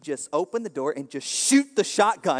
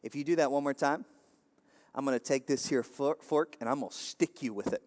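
steely warning; 2 semitones lower, 7 dB quieter than usual. Retold or imitated. Granny warns dad off her plate, quiet steely threat. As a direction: deliver a soft, measured warning with pauses. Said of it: "looked at him with that still-eyed look".

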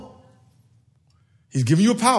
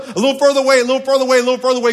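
raised, insistent repetition; louder repeated emphasis; building repetition; 6 semitones higher, 5 dB louder, much faster than usual. Shown as a demonstration. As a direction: push each one further out. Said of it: "repeated for emphasis".

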